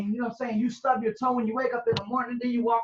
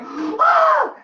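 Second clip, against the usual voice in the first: sudden high scream "Ah!"; shocked scream; 8 dB louder than usual. Retold or imitated. Woman seeing two lines, shrieks in shock. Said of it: "She's like".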